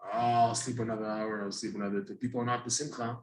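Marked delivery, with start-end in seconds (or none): 0.0-2.2 low, subdued reluctance to get up; reluctant riser. Retold or imitated. Imitating people who won't get up, low and reluctant. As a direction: mumble it like you won't leave bed. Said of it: "People, people are not happy. They don't wanna get up".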